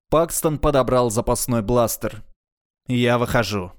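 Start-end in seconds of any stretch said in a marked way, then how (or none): none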